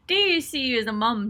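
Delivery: usual speaking voice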